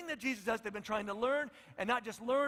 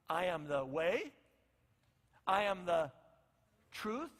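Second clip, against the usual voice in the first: slow solemn declaration; 2 semitones lower, slower than usual. Retold or imitated.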